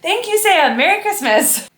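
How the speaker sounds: high, loud, excited thank-you. Real, not acted. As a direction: sing out the thanks, thrilled. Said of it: "Very excited".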